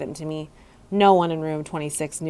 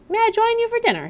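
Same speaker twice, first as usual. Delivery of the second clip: higher, quicker polite ask; polite custodian; faster than usual. A narrator's reading. Custodian asking the hamster to share dinner, lightly voiced. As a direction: ask to join him kindly.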